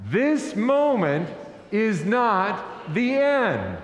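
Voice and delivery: slow, deliberate refrain, each word spaced; drawn-out preacher's call to repeat; leading a congregational refrain. Real, not acted. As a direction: lead the room in saying it together, slowly. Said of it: "say that with me now".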